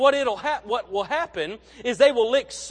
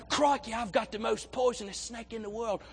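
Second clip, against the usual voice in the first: put-on light Australian accent as Steve Irwin, a bit softer; a playful Aussie Crocodile Hunter take; mild Australian accent as an excited TV naturalist; Steve Irwin impression; 5 dB quieter than usual. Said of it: "He's like".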